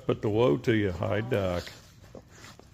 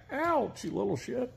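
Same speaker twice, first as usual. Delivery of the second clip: squeaky high yelp into fond scolding; high startled ouch, teasing insult; high-pitched ouch; 11 semitones higher, 4 dB quieter than usual. Real, not acted. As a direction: yelp at the nip then scold. Nipped by coyote.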